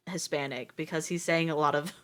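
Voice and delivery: ordinary delivery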